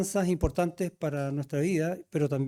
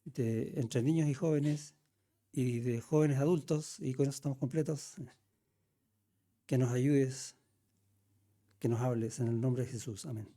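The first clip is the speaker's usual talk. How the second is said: low, softened prayer, phrase by phrase; 4 semitones lower, 3 dB quieter than usual. Real, not acted. Pastor praying for the Sunday school classes, low reverent prayer. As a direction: pray aloud in a subdued, lower voice.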